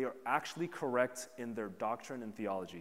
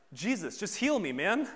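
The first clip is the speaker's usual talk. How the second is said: loud, high, exasperated plea; imagined angry demand; 7 semitones higher, 7 dB louder than usual. Retold or imitated. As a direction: snap at Jesus like a fed-up sick man. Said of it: "He could have been like".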